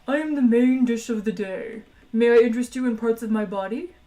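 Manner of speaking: the cheerful Dish of the Day selling its own body; talking meat animal. Acted. Eager talking cow offering itself to diners, polite creature voice.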